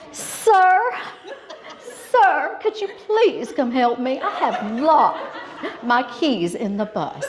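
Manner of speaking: high, drawn-out sugary Southern plea; sweetest Southern voice. Retold or imitated. Colleague asking a stranger for help with locked-in keys, sweetest Southern voice. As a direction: imitate a Southern belle sweetly begging for help. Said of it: "In her sweetest Southern voice".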